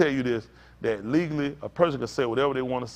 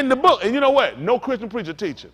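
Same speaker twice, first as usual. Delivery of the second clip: loud, high-pitched preaching; full-force preacher delivery; forceful preaching; 6 semitones higher, 6 dB louder than usual. Real, not acted. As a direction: hammer out the story to the congregation.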